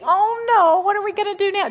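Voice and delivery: hammed-up panic; mock panic. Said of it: "here I was thinking".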